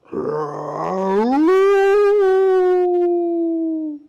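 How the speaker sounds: long, loud, rising howl; storybook wolf's drawn-out "awoo". Acted. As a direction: howl high and hold it.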